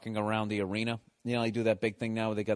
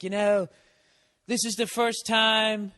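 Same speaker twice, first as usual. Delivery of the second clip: higher, louder, slow with milked pauses; mocking dramatic singer; 9 semitones higher, 5 dB louder, slower than usual. Club singer milking the crowd, mocking slow dramatic delivery. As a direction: ham up a singer's earnest pause. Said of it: "with the stupid dramatic pauses".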